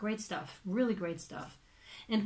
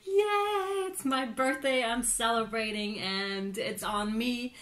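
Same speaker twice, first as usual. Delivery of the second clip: giddy birthday cheer; 2 semitones higher, slower than usual. Retold or imitated. Reader voicing the birthday client, giddy and generous. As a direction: celebrate as if treating everyone. Voices celebrating birthday person.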